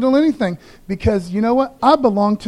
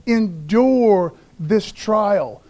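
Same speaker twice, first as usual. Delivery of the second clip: drawn-out, weighty "Endure this trial"; slowly urging the congregation to endure; slow emphatic exhortation; much slower than usual. Real, not acted. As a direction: lean on every word, slowly.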